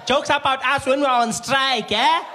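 loud, high, drawn-out taunt; caricatured woman with a grudge; accusing receptionist. Acted. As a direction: confront the comedian slowly.

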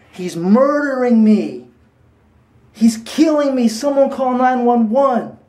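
high, drawn-out mock scream; imitated screaming. Telling a friend what was heard outside, imitated screaming. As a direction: cry out like a victim begging for help. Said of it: "as loud as they could".

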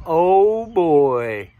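loud "oh" sinking low; snake character. Acted. Snake sees all the balls coming, loud then sinking dread. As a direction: dread it, dropping on the last word. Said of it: "He says".

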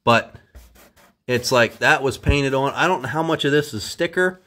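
ordinary delivery

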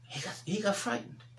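fervent preacher, rising and falling; preaching. Acted. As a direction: preach it out to the congregation. Preaching at full force.

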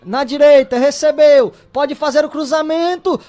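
normal talking voice

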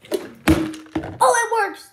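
high, loud delighted gasp; excited. Real, not acted. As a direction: burst out with surprise. Flip finally works.

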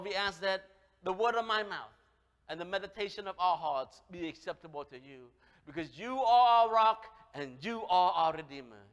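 ordinary talk

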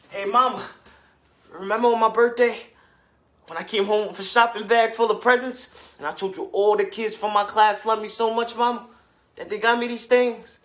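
high, loud and bubbling over; eager child calling Mama. Acted. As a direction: pour it out to Mama like an excited kid. Child boasting to mother of presents.

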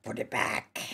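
very low, creaky demand; low mock threat. Player ordering the game to restore it, low mock threat. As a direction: drop right down into a creak.

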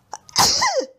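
high, loud spluttering sneeze. A narrator's reading. Bedraggled teddy bear just out of the sea, sneezing. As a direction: sneeze and splutter in a sharp burst. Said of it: "He sneezed and spluttered".

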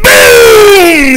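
big loud high boom; thunder boom. A narrator's reading. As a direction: shout it like a thunderclap. Thunder crashes in story.

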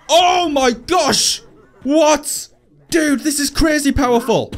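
high, stretched-out astonished exclamation; stunned, high-pitched gamer amazement; amazed outburst. Real, not acted. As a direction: blurt it out in awed disbelief. Amazed at the gauntlet's power.

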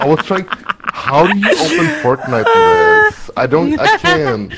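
very loud, high outburst; loud, exasperated wail; frustrated yelling. Real, not acted. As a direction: shout helplessly, far over normal volume.

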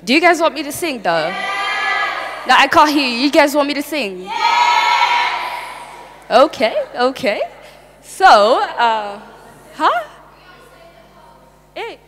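loud, high crowd hype; hyping the crowd. Real, not acted. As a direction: pump up the audience.

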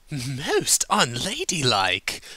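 high, loud, slow and haughty; sniffy Rarity. A narrator's reading. Rarity scolding drunk Applejack, slow haughty high voice. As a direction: sniff it out disapprovingly. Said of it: "Rarity sniffed".

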